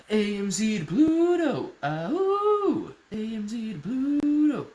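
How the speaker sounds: high, slow chant, stretching out Pluto; drawn-out hype cheer. Real, not acted. Streamer hyping stock to viewers, drawn-out high cheer. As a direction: whoop it out high with long held vowels.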